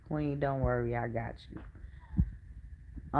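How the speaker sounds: soft, slow near-whisper comfort; reassuring. Retold or imitated. Telling the young mother not to worry, soft and reassuring. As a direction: hush her gently, I've got this. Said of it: "I said".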